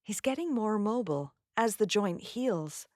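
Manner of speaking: American pronunciation, MOBILE as "MOH-bul"; American accent. Shown as a demonstration. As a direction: say it as an American, MOBILE with a reduced ending. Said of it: "In an American accent".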